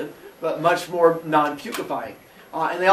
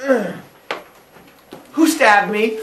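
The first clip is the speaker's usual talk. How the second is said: louder, fed-up grunt and question; annoyed groan; 5 semitones higher, 6 dB louder, slower than usual. Acted. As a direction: groan like a dad stabbed twice. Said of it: "And Father Sky's like".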